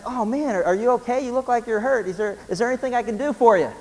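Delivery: a lightly voiced anxious young man; worried young driver. Young guy checks on the old man.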